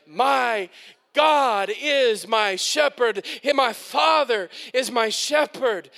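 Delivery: impassioned sermon, slow and swelling; rising pulpit fervour; preaching. Acted. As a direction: preach it to the whole room. Preaching at full force.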